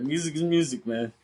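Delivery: playful interview impression. Imitates [name] in interview.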